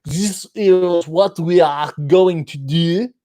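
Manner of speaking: slow French accent on every word; overdone fake French accent. Shown as a demonstration. Showing people trying to do accents, overdone French. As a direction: labour each word in mock French. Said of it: "they try to make every word indicate that they're doing that accent".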